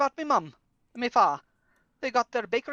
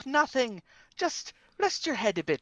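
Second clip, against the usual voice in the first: ordinary talk, nothing marked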